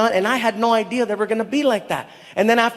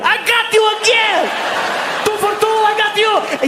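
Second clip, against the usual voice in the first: put-on very high Saudi-accented crowing; giddy Arabic-accented gloat; gleeful gloating; 13 semitones higher than usual. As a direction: squeal with prankster's triumph. Saudi local gloats over second prank.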